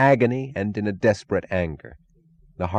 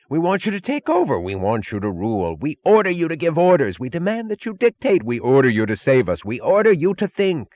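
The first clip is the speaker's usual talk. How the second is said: high, loud shrieking demands; indiscriminate shrieks; 8 semitones higher, 4 dB louder than usual. A narrator's reading. The watchers shriek orders at Galt in mounting panic. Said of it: "progression of indiscriminate shrieks".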